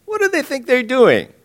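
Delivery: amused laugh. Acted. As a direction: chuckle it out, high and mocking. God laughing at rebels.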